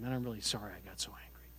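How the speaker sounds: near-whispered, quick retold apology; hushed apology. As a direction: murmur it low, as if confessing privately. Retold private apology in the car.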